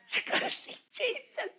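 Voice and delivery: very high, soft, thin half-whisper; hushed wonder. Acted. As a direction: lift it high and barely voice it. Repeats assurance in thin voice.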